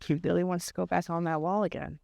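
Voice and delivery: ordinary delivery, nothing marked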